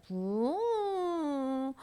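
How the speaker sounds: drawn-out imitated boat hum; boat sound. Preacher mimics the boat leaving for children, droning boat noise.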